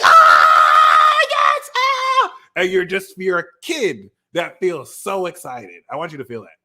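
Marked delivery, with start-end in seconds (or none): 0.0-2.3 shrieking high and loud; thrilled kid. Retold or imitated. Five-year-old hearing about Six Flags, loud high squeal. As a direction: squeal with pure kid joy. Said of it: "you're like".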